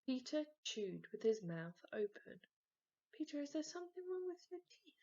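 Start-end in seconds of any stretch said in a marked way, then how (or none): 3.1-4.9 much higher, quick anxious mum; Mum's concern. A narrator's reading. Mum asks son at dinner, quick and worried. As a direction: fuss over him like a worried mother. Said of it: "asked Mum".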